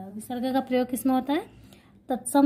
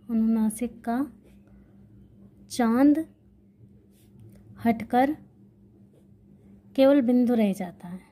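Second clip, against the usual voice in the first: word by word with long pauses between; slow dictation. Shown as a demonstration. Teacher dictating the rule for students to note down, slow with pauses. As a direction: dictate it slowly so students can write. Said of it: "तो".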